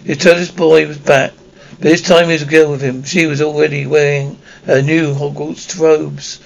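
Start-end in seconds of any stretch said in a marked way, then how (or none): none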